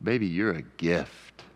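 low, slow and tender, eyes locked on your spouse. Pretend. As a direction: tell your spouse they're a gift, softly and sincerely. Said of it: "look at 'em right in the eyes and go".

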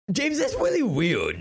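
high, speech-impaired voice; Bruce Wayne. Acted. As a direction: slip into a silly high register, muddling "weird".

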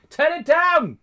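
high, loud imitation of mum yelling; shouting mother. Mother shouting from the other room at her child, imitated shout. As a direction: yell like a parent through the house. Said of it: "I could hear her shouting from the other room going".

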